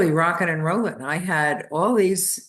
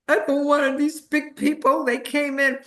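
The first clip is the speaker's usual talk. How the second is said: put-on high, whining little-girl voice, much higher; childish whimper, pitched way up; whiny child; 9 semitones higher than usual. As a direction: play the upset ten-year-old pleading with dad. Child retelling tantrum to father.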